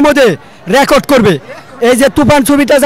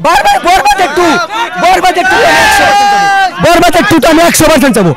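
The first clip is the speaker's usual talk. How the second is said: excited; 4 semitones higher, faster than usual. Real, not acted. Excited fan at cinema hall.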